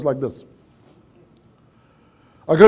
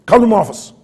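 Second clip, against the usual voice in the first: imitating a gruff boss's loud, higher bark; barked order; 6 semitones higher, 7 dB louder, faster than usual. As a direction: bark it abruptly, like a boss down the phone. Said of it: "He just barks".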